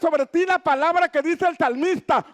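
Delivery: high, rising sermon voice building to shouts; full-force preaching. Acted. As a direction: preach it out to the whole church, slow and rousing. (predicación a todo pulmón, alta y enfática)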